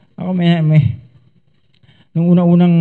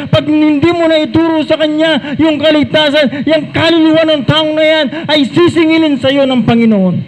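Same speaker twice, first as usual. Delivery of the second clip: rising, insistent sermon voice; preacher building to a warning; fervent preaching; 5 semitones higher than usual. Acted. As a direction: drive the warning home to the congregation. Preaching climax.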